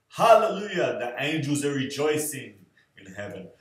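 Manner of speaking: loud, high hallelujah, easing as it goes; mock rejoicing. Celebrating that the holey shirt is finally gone, mock-jubilant. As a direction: exclaim like a hammed-up praise shout.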